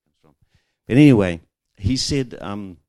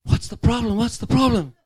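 calling after her; 13 semitones higher, 11 dB louder than usual. Retold or imitated. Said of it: "goes after and says".

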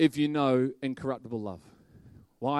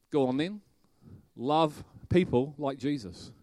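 usual speaking voice

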